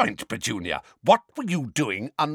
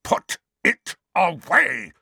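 high, loud, drawn-out angry snarl; furious, strained command; Uncle Vernon's snarl; 10 semitones higher, 5 dB louder, slower than usual. A narrator's reading. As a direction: snarl the order slowly, forcing out each word. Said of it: "Uncle Vernon snarled into Harry's ear".

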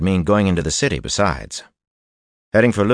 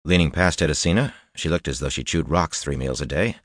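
ordinary delivery

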